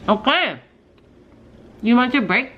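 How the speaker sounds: high, loud pet talk. Real, not acted. Talking to the dog.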